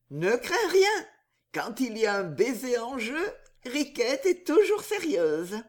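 high, playful woman's voice; lilting mock-solemn assurance; Riquette. Riquette promising Riquet to stay serious, high and playful.

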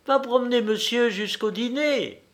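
high-pitched wife sending her husband out; brisk high voice, an instruction to a husband. A narrator's reading. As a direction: order your husband along in a high, clear voice. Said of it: "sortir vivement, disant à son mari".

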